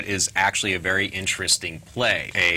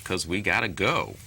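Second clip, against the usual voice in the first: usual speaking voice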